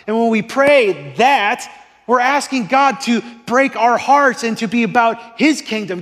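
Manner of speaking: high, loud, driving sermon voice; full-force preaching. Acted.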